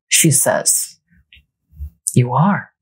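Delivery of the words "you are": usual speaking voice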